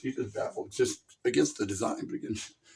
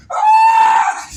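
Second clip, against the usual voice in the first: very high, loud scream; hammed-up scream; shriek; 20 dB louder than usual. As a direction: scream as the statue falls. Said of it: "he's like".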